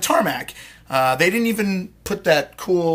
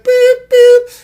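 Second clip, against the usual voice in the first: high, loud mock truck beeps; imitated reversing beeps; 13 dB louder than usual. Telling how the cart backs up, high loud imitated beeps. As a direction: beep shrilly like a backup alarm.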